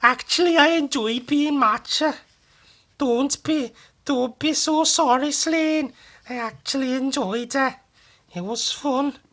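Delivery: very high, sing-song Welsh voice; Welsh accent. Shown as a demonstration. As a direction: lean into an exaggerated Welsh lilt. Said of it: "That was Welsh".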